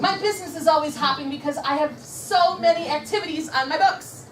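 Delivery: high, emphatic declaration; rally the room line by line; powerful affirmation. Shown as a demonstration. Trainer has consultants repeat after her, powerful and emphatic. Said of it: "this is a powerful affirmation".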